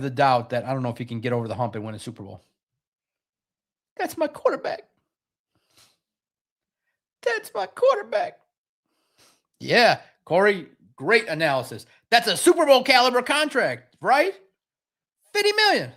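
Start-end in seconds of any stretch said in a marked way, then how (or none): 4.0-4.8 mock devoted fan. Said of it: "mimicking Dan". 7.2-8.4 high, slow, squeaky mocking quote; squeaky mock fan. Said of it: "mimicking Dan". 15.3-15.9 excited. Real, not acted. Exclaiming contract.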